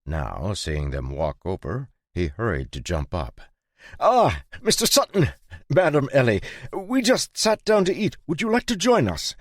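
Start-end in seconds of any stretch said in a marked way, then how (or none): 4.0-9.4 high, fawning merchant; squeaky, eager-to-please host. A narrator's reading. Gottfried's voice, high.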